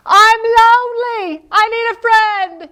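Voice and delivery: Little Beaver calling for a friend; mock lonely cry. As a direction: plead like a lonely child. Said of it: "He says".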